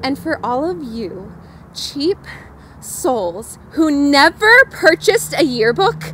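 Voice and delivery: loud, slow sneering jab; mocking taunt. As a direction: sneer at the cheapskates, loudly. Taunting the cheap ones.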